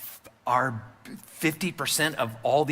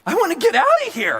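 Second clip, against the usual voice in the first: much higher, louder and fast, panicked; voice up an octave; 9 dB louder, faster than usual. Retold or imitated. Young pastor blurting to his mentor, high panicked blurt. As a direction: blurt it like a cornered kid. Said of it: "my voice went up an octave".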